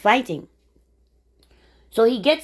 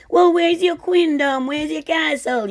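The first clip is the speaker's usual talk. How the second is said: 1 mocking challenge; 9 semitones higher, 6 dB louder than usual. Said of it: "they're gonna say".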